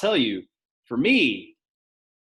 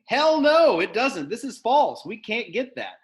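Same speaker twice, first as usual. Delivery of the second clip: emphatic hell no; 9 semitones higher, 4 dB louder, slower than usual. Shown as a demonstration. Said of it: "emphatic answer demo".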